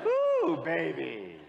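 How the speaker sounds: high squeal then drop; gleeful high exclamation; excited ooh. Real, not acted. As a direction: cheer them on with delight. Preacher reacts to congregation.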